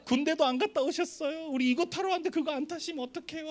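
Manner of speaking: put-on high-pitched, needling girlish voice; taunting young woman. Youth leader wounding pastor's pride before the ride, high taunting voice. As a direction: goad him in a high mock-girlish whine. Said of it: "그 여자 임원이 갑자기 막 목사님 자존심 상한 소리를".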